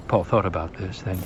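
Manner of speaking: ordinary delivery, nothing marked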